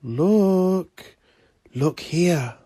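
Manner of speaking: loud, high, drawn-out call; eerie calling out. Acted. Sinister voice calls Declan to look, calling out loudly.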